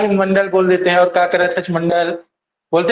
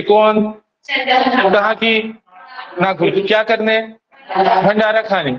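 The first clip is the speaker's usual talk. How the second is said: "कौन, कहाँ की" drawn out very slowly, word by word; slow drill repeat; slower than usual. Shown as a demonstration. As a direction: spell it out slowly for students to repeat. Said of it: "एक बार फिर से". (धीरे धीरे दोहराना)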